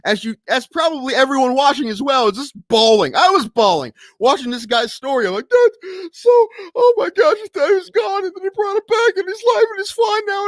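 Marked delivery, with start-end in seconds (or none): 5.5-10.5 high, tearful wail, pitched way up; hammed-up weepy voice; mock crying voice. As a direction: retell the sobbing in a strained, squeaky pitch. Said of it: "And I was crying".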